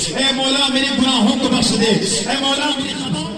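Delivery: high-pitched, sustained sermon chant; impassioned preaching. Acted. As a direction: preach it out high and ringing to the congregation. Preaches at full force.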